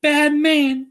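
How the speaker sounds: put-on high girlish voice for Dorothy; high young girl scolding the wizard; Dorothy's voice. A narrator's reading. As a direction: raise the voice for the little girl. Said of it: "said Dorothy".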